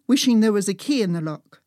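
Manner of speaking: ordinary delivery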